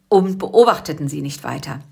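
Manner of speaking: ordinary delivery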